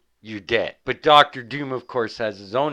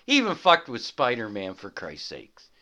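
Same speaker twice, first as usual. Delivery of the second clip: usual speaking voice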